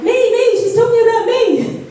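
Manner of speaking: high, excitable squeal for attention; pick-me excitement in a high voice; eager inner voice. Acted.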